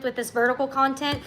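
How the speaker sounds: normal talking voice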